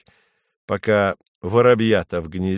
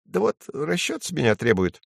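high little sparrow voice; high-pitched small bird; chirping sparrow; 6 semitones higher than usual. A narrator's reading. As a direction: chirp the complaint. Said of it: "чирикает воробей".

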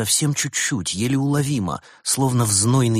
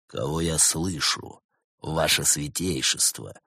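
low, slow voice; 6 semitones lower, 5 dB quieter, slower than usual. A narrator's reading. Bars answers rival on phone.

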